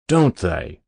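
taken aback at being corrected; shocked. A narrator's reading. Adjutant corrected by Lean on the service, mildly shocked. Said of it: "shocked that he had made the mistake".

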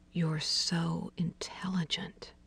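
slow, soft breathy murmur. A narrator's reading. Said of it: "he murmured".

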